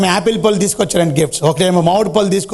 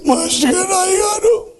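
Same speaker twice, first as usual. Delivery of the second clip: put-on very high, sobbing boy; crying child; 11 semitones higher than usual. A narrator's reading. Boy calls the pastor, sobbing high. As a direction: wail it through tears. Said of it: "ఈడు మాత్రం ఏడుతూ".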